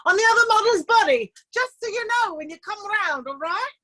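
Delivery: breezy, cheeky put-on voice of a classmate; Sherman. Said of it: "She says".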